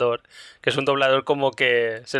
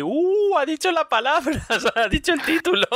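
excited fan squeal, much higher; Homer style; 14 semitones higher than usual. Retold or imitated. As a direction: shout it like Homer Simpson spotting the title. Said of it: "con rollo Homer". (como Homer, chillido emocionado mucho más agudo)

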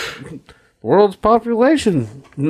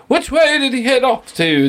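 a lightly voiced higher inspector asking; playful put-on detective question; mock inspector; 12 semitones higher than usual. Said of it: "inspector dude runs up and goes".

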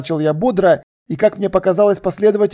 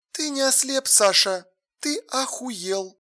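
very soft, crestfallen woman; sunken voice; 6 semitones higher, 8 dB quieter than usual. A narrator's reading. Alla reacting to Sasha's blindness claim, crestfallen. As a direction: let the voice sink, quiet and drained. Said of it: "произнесла она упавшим голосом".

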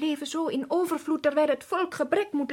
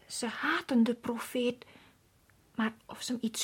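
fearful whisper; 5 dB quieter than usual. Acted. Servant afraid of the priests.

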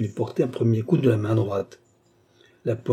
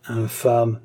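plain reading voice

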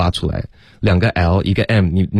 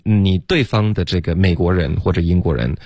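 ordinary talk, nothing marked

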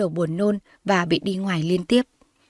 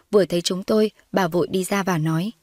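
ordinary delivery

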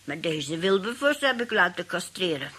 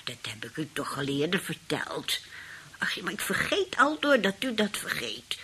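hushed, whispered aside; whispering gossip between old ladies; conspiratorial whisper; 3 semitones lower than usual. Acted. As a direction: whisper it so he can't hear.

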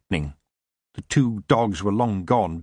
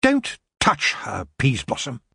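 commanding voice; 4 semitones higher than usual. A narrator's reading. Nightshade halting the elf lord, commanding. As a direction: stop him with a cold order. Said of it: "A voice said".

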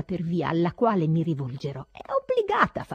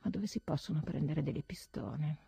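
anxious whisper; 2 semitones lower, 7 dB quieter than usual. Acted.

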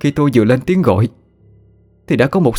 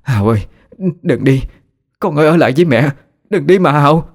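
wailing, higher and louder, pleading; a mother wailing for her dead son; loud crying; 7 semitones higher than usual. Acted. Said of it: "khóc lớn lên".

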